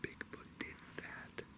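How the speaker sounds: slow, barely audible whisper; hushed whisper. Real, not acted. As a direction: whisper it slowly, very softly. Hushed comment on a find.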